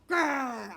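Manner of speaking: loud drawn-out grrr; angry puppy growl. Acted. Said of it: "make my angry puppy face and growl".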